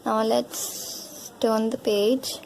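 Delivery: ordinary delivery, nothing marked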